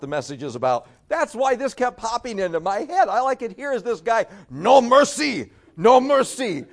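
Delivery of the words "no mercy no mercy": loud, high, slow war cry; hammed-up dojo battle chant; acted ruthless shout. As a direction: shout it like a merciless karate master. Acts out the ruthless dojo leader.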